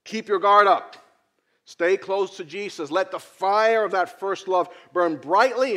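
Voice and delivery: preaching. Acted. Preacher exhorts the congregation.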